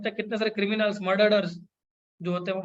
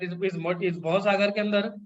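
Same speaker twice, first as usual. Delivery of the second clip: usual speaking voice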